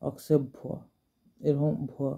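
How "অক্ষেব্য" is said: অক্ষেব্য with an s after the k; correct pronunciation. Shown as a demonstration. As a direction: say অক্ষেব্য with a clear "ks", as "oksebbho". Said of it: "এরকম টাইপের উচ্চারণটা হবে".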